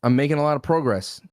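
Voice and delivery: "progress" with a long O in the first syllable; PROH-gress pronunciation. Shown as a demonstration. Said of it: "You say progress".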